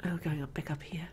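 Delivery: whispering. Real, not acted. Sneaking past monster.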